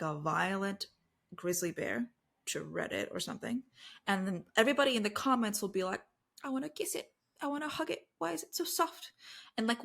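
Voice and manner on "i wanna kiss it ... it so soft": high, soft cooing over a cute animal; imitated adoring squeal, kept small and quiet; mock gushing. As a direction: coo it softly in a raised voice, imitating smitten fans. Imitating gushing commenters on bear photo.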